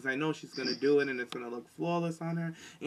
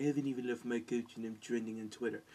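imitated foreign accent, lower and softer; Maryse's accent; 3 semitones lower, 6 dB quieter than usual. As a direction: put on a foreign accent, voice dropped. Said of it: "in her accent".

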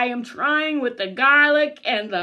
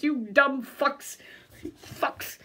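ordinary talk

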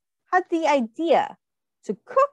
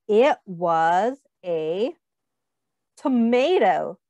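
slow, drawn-out reveal; storyteller's slow reveal; 4 semitones lower, much slower than usual. Acted. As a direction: stretch it out for suspense. Dramatic reveal to children.

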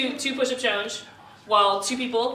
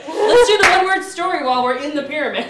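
excited; 5 semitones higher, 9 dB louder than usual. Real, not acted. Excited idea, laughing crowd.

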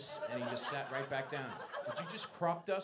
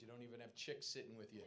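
usual speaking voice

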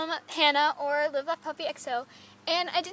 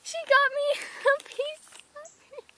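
overjoyed squeal; 10 semitones higher than usual. Real, not acted. Finding a special gift from Hannah, very high laughing squeal. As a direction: squeak with disbelieving joy.

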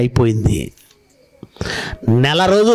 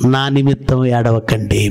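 slow, lower delivery; measured scripture quote; 4 semitones lower, slower than usual. Acted. Preacher quoting Jesus's words to the congregation, measured scripture quote. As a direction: quote it slowly and solemnly. Quotes the verse solemnly, then repeats it.

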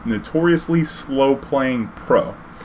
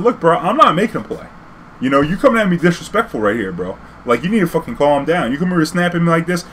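heated retort; 6 semitones higher than usual. Retold or imitated. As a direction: tell the judge off. Arguing with judge.

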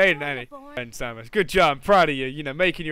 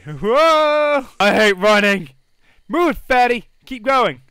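excited cheering; 4 semitones higher, 6 dB louder, much slower than usual. Real, not acted. Cheering sim on jog.

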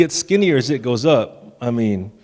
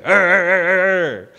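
repeated higher-pitched mock growls; toddler monster growl; 5 semitones higher, slower than usual. Dad imitating Caden answering his sisters, toddler monster growling. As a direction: growl like a small boy being a monster. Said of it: "Kaden was just like".

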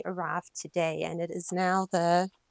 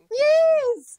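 much higher, loud cry of joy; triumphant yes; 12 dB louder than usual. Real, not acted. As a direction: cheer it out.

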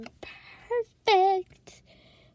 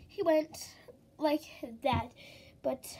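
ordinary talk

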